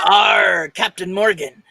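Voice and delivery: loud laughing pirate shout. Acted. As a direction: belt it like a pirate. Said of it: "Mine would say".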